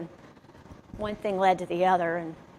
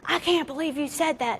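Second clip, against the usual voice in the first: higher, hurt indignant line; mild mock outrage, pitched up; retold temper flare; 8 semitones higher than usual. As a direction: let the Irish temper flare. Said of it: "Irish temper to just flare up".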